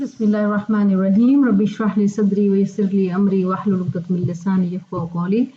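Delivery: measured, chanted opening prayer; Quranic recitation. Acted. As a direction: recite the opening invocation devoutly. Opening recitation before lesson. (Quran की तिलावत के अंदाज़ में)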